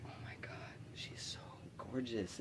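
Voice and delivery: hushed whisper, nearly voiceless; breathless, smitten aside under your breath; awed whisper. Retold or imitated. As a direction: whisper it in awe to yourself. Awed aside on seeing the girl.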